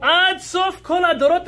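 high, ringing exclamation of wonder; rising excitement. Real, not acted. Teacher thrilling his class with the Gemara's promise, high excited exclaim. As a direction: lift it up high with awe, to the end of all generations.

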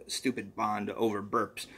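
ordinary delivery, nothing marked

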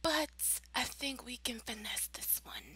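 conspiratorial whisper. Real, not acted. Plotting a fix for Gabby's party, conspiratorial whisper. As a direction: let the viewers in on a secret plan. Scheming aside to viewers.

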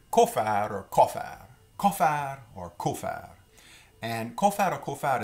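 "cofaire" said as KO-fair, repeated loudly; modeled pronunciation. Shown as a demonstration. Teacher repeats "cofaire" to show the Louisiana sound, KO-fair. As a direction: say "cofaire" with a clear KO and a rolled ending. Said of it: "we typically pronounce it".

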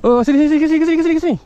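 very high, loud chanted commands; frantic urging. Real, not acted. Angler steering a big fish from snags, frantic. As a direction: shout frantic repeats at the top of the voice. Panicked directing during fish fight.

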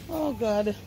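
ordinary talk

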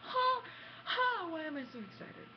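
high, loud victory gloating with ha ha; triumphant gloating. Retold or imitated. Gamer winning Blitzball, gloating high and loud at the screen. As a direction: crow over the beaten team. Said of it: "I'm like".

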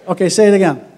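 rally the congregation to repeat it; loud call to the crowd. Shown as a demonstration. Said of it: "I said, "Say it again".

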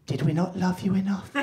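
hurt mum, softly pleading; mock bargaining. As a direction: plead quietly like a wounded parent. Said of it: "the bargaining what-if stage".

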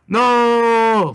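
loud, high, drawn-out "No"; long cry of no. Acted. Cleric sees the timer broken, crying out in protest. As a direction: wail it out and hold the vowel.

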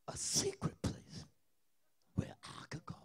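breathy whispered secret. Real, not acted. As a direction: whisper it like a confession. Whispered sermon line.

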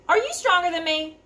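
high, challenging goat question; demanding middle goat. Acted. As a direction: demand an answer from the troll. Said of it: "demanded Middle Billy Goat Gruff".

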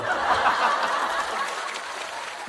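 audience laughing; a wave of crowd laughter. Real, not acted. Audience reacts.

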